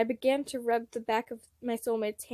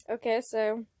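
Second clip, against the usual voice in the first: ordinary delivery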